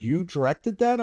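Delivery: retold excitement. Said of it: "like, really excited".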